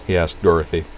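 ordinary delivery, nothing marked

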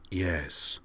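soft alien reply; hushed, eerie voice. A narrator's reading. Kosh confirming his name to Griffin, hushed and eerie. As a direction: answer in an eerie hush. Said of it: "rather hushed and eerie voice".

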